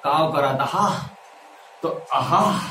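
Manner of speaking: slow mock Pratapgarh reading; stretched, hammed-up Pratapgarh "आहा"; Pratapgarh-style recitation. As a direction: imitate Pratapgarh speakers, drawing out the vowels. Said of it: "वो लोग ऐसे बोलते हैं".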